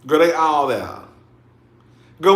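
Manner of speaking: usual speaking voice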